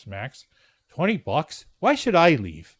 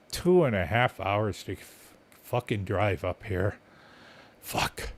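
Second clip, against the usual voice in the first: muttered frustration; 7 semitones lower, 6 dB quieter, slower than usual. Retold or imitated.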